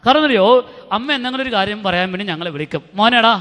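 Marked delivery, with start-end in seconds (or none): 3.0-3.3 put-on louder, mother calling the boy over. Mother calling her son to come over, louder. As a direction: call out across the house to the son.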